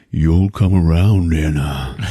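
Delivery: slow, put-on creepy killer voice. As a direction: play the stalker, drawing words out. Killer answering his victim, retold.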